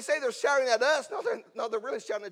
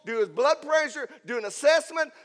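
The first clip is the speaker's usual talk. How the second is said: loud, higher, driving preacher's delivery; impassioned plea; 4 semitones higher, 6 dB louder than usual. Acted. Centurion urging Jesus to just speak, impassioned. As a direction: press the plea forcefully, word by word.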